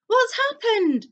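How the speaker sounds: high, slow, alarmed question; a boy caught off guard by panic; startled boy asking what's wrong; alarmed Harry. A narrator's reading. Harry startled by fleeing Dudley.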